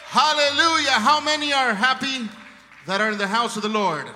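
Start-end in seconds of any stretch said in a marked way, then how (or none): none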